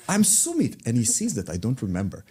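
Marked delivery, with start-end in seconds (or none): 0.1-0.9 eager introduction. Retold or imitated. Man introduces himself eagerly.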